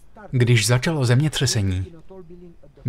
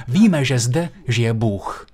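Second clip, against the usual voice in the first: slow solemn declaration; much slower than usual. Retold or imitated.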